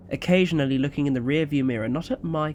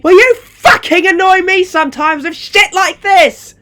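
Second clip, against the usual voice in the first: much higher and louder, spitting fury; angry yell; 17 dB louder than usual. Acted. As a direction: hurl it back at him. Mother yelling at son.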